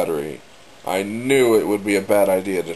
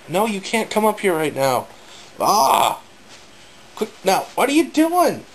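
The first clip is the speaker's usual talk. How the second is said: high scolding yell at the dog; yelling across the room; 11 semitones higher than usual. Real, not acted. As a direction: shoo the dog off, sharp and high. Dog jumps up, she scolds.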